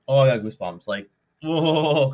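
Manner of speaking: loud, spooked exclamation; creeped out. Real, not acted. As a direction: react with real chills. Creeped out by coincidence.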